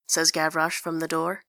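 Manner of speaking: ordinary delivery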